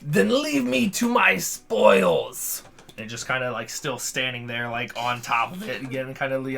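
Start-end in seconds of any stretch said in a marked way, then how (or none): 0.0-2.6 slow, high cracking dragon roar; a young dragon whose voice breaks mid-roar; half dragon voice. Acted. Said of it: "adolescence breaking through in a dragon roar".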